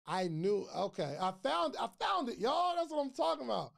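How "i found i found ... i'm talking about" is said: high, thrilled at solving the problem; real excitement, pitched up; excited. Real, not acted. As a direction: cheer that it finally works. Fixed the audio problem live.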